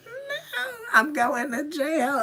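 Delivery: high, wailing cry-talk, slowed at the end; mock crying wail. Telling the cop she is going to jail, crying. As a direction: imitate your own sobbing panic. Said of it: "I was like".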